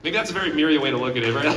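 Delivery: ordinary delivery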